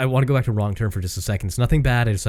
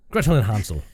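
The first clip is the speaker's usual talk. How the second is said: light Irish lilt on a title; playful mock Irish accent; put-on Irish accent; 2 semitones higher, 3 dB louder than usual. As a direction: announce it with a bit of Irish. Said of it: "I can't do accents".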